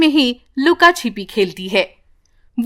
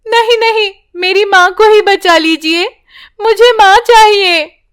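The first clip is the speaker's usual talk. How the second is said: put-on higher, louder frightened child; pleading little girl; 8 semitones higher, 3 dB louder than usual. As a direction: beg to save your mother.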